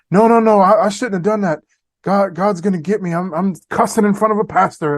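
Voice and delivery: a guilty man blurting out his fear; panicked apology. Retold or imitated. Man caught cussing begs the pastor's pardon, panicky.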